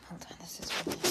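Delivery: breathy hushed whisper, stopping herself. Real, not acted. Mom catches herself and whispers to her kids. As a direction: drop to a whisper mid-sentence. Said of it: "I've been yelling, hold on".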